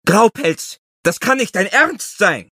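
high, shrill, loud outburst; shrill cry. A narrator's reading. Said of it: "Seine Stimme hallte schrill".

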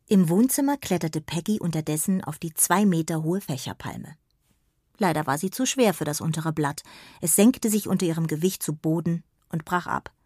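ordinary delivery, nothing marked

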